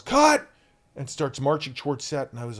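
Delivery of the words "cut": loud, high shout of cut; yelling cut. Retold or imitated. The director stops the take, loud high yell. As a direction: call it out across the set. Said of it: "the boss yells".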